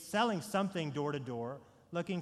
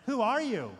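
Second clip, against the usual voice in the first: Truman's cry; 6 semitones higher, 7 dB louder than usual. Retold or imitated. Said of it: "and Truman asks".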